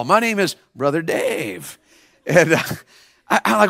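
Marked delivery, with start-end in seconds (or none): 0.0-1.8 slow, low, softened introduction; mimicking kid's delivery. Preacher introduces himself to the kid, mimicking his slow delivery. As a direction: echo his drawn-out way of talking.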